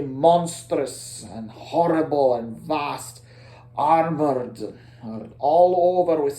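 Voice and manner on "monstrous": usual speaking voice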